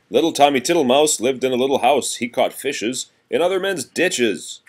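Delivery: lively sing-song nursery rhyme reading; reading to children. Acted. Parent reading seasons rhyme to a toddler, sing-song. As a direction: read it bouncy for a small child.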